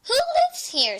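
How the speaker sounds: high, curious little question; wondering aloud. A narrator's reading. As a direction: wonder who lives here. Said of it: "she wondered".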